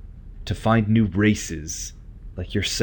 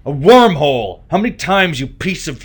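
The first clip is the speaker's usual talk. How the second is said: angry yelling; 8 semitones higher, 8 dB louder than usual. Acted. Prisoner yelling at interrogator.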